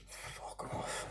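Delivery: breathy whispered fuck off under his breath; whispered curse. Real, not acted. Reviewer whispers his annoyance at the villain. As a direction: hiss it quietly to yourself. Muttered reaction again.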